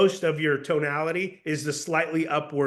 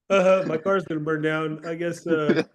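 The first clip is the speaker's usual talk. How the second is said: mock indifference. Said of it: "you're like".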